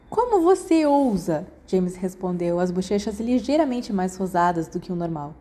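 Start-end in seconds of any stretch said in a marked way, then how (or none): 0.1-1.5 mock outrage. A narrator's reading. James mock-offended, blushing.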